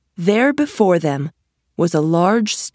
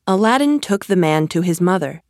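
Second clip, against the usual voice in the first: usual speaking voice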